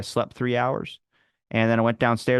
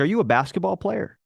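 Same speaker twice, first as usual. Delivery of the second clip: hotel clerk asking, voice raised higher; a bright, curious clerk asking; curious clerk question; 8 semitones higher than usual. Retold or imitated. Clerk quizzing the tall-looking guest, higher curious voice. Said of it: "she was like".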